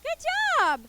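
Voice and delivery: high, bright cheer; excited praise. Real, not acted. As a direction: praise them loudly, up high. Praising kids.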